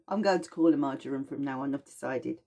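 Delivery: ordinary delivery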